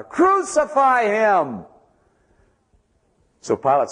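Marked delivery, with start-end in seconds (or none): 0.0-1.6 "Crucify him!" shouted much higher and stretched out very slowly; louder crowd shout. Acted. Preacher voicing the crowd shouting all the louder, high slow shout. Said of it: "And they shouted all the louder".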